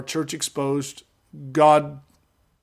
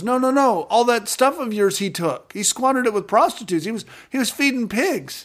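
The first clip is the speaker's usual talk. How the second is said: put-on indignant older son; 7 semitones higher than usual. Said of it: "But the other son goes".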